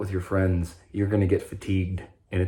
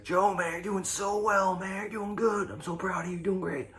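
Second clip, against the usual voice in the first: much higher, softer voice praising Joe; proud friends; 12 semitones higher, 5 dB quieter than usual. Retold or imitated. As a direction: play the proud friends cheering him on, high and soft. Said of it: "everybody was like".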